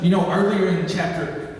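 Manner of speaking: usual speaking voice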